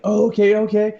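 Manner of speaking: lightly voiced five-year-old getting excited; a hint of an eager little boy; excited little brother. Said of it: "he's like".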